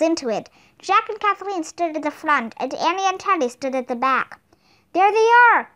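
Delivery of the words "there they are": loud high alarmed shout; alarmed cry on sighting the wolves; cried out. A narrator's reading. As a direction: call out as you spot them. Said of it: "cried Teddy".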